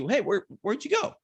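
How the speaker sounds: high, puzzled voice for the bull; mock bull voice. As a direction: play the bull searching for the spike. Voicing the bull looking around.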